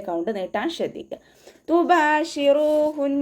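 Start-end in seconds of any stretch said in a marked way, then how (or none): 1.7-3.1 തുബാഷിറൂഹുൻ chanted slowly, vowels drawn two counts; tajweed recitation. Shown as a demonstration. Teacher models the word for students, slow chant. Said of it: "ബ കൂടെ അലിഫ് സ്വായറയാണ്".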